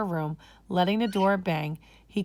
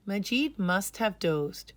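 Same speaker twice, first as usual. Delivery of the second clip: ordinary delivery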